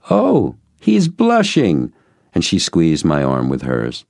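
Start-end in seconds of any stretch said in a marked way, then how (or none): 0.1-1.9 high, drawn-out coo; slow, high-pitched gushing; teasing. A narrator's reading. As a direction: tease a shy guest with delight. Wife teases the blushing guest.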